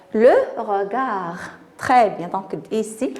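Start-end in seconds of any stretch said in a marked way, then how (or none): 0.1-1.4 slow "regard" ending on the r; silent final D. Shown as a demonstration. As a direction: leave the D of regard unsounded. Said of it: "je n'entends pas D". (regard lentement, D final muet)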